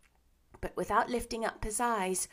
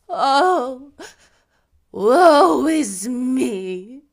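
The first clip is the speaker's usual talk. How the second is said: loud, very slow ghostly wail; wailing lament; 4 semitones higher, 16 dB louder, much slower than usual. A narrator's reading. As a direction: moan it out in despair.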